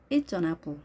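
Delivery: short, linked "It's an apple"; short contraction. Shown as a demonstration. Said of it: "이렇게 짧게 하는 것을". (It's an apple을 짧게 이어서)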